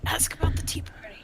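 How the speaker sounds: high, soft whisper. Real, not acted. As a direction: hiss it urgently. Repeats the suggestion, still whispered.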